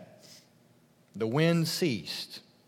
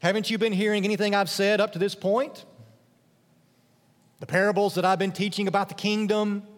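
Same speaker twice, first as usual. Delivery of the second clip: higher and fast, pressing questions; exasperated Jesus; 6 semitones higher, 3 dB louder than usual. Acted. Jesus scolding inattentive disciples, higher and fast.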